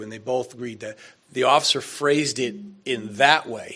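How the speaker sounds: ordinary delivery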